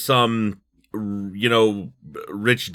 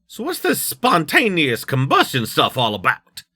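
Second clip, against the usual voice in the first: higher-pitched character voice with a put-on accent; Nix's put-on accent; 5 semitones higher than usual. Questioning a colleague about the case, in a put-on accent. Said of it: "why I gave Nix that accent".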